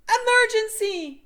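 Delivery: very high, loud scream. A narrator's reading. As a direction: scream in panic. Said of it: "screamed Sam".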